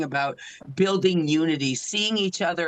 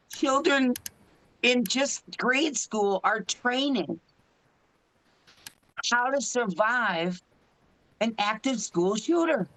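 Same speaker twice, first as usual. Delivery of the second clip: higher and slow, the throat tightening; choked up; 5 semitones higher, slower than usual. Real, not acted. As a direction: fight back real tears on each word. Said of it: "I'm gonna get choked up right now".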